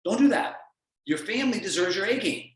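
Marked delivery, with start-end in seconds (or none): 0.0-0.6 louder with a lift on DO; firm coach warning. Real, not acted. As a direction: repeat the warning firmly. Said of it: "calling you out on it as your coach".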